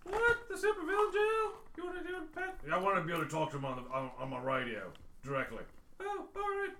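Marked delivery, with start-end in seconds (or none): 0.0-2.6 much higher, put-on dispatcher voice. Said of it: "oh yeah, Beatrice". 6.0-6.7 much higher, put-on dispatcher voice. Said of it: "oh yeah, Beatrice".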